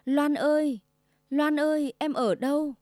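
gọi to, kéo dài tên Loan; gọi vọng ra sông tìm vợ; calling out. A narrator's reading. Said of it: "cất tiếng réo gọi". (gọi lớn thảng thốt)

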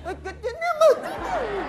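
very high, slow, strangled nonsense syllables; mock mime squeak. Patient miming to doctor, high strangled squeak. Patient mimes without sound.

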